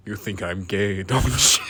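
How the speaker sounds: bursting into laughter mid-accusation; real laugh breaking through the words. Real, not acted. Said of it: "I can't fucking say that without laughing".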